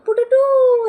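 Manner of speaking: very high, loud cutesy pututu; mock pututu noise. Copying the lady's cutesy pututu after every sentence, very high. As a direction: pop out a silly high pututu. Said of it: "ऐसा बोलती थी।".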